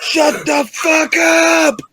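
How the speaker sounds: long, drawn-out high mock shout; grandpa yelling. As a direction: stretch it into an imitation grandpa roar. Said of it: "he's yelling at us".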